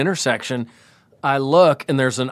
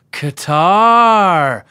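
put-on drunk small talk; 2 semitones higher, 5 dB louder, much slower than usual. Drunk friend replying to store owner.

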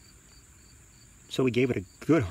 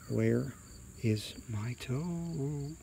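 soft, slow, drawn-out spooky groan; hushed eerie call, last word held long; haunt them with a long, quiet groan; groaning ghostly voice; 3 semitones higher, 7 dB quieter, much slower than usual. A narrator's reading. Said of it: "it groaned".

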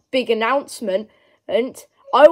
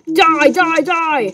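shouting die; 6 semitones higher, much slower than usual. Real, not acted. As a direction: yell it as you shoot. Shooting enemy.

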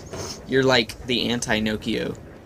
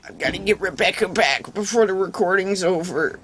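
high silly voice; 7 semitones higher than usual. Pretend. Put-on high voice.

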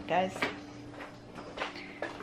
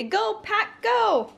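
cheering; 11 semitones higher, much slower than usual. Shown as a demonstration.

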